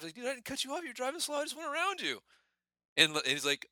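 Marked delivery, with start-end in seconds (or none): 0.2-2.2 high-pitched exasperated defense; imitated indignant protest. Storyteller as his buddy answering the truck driver, high indignant protest. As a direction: protest innocence in a much higher voice.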